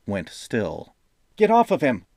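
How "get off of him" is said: much higher, louder command. A narrator's reading. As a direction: order the men off. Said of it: "Jaden commanded".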